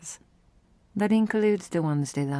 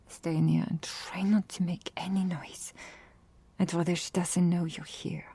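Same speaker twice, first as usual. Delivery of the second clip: hushed, breathless warning whisper; urgent whisper; 6 dB quieter than usual. Acted. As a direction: whisper fast so the visitor can't hear. Hiding human from arriving sister.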